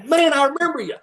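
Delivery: high-pitched mock amazement; feigned delighted recognition. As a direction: pretend to suddenly remember him.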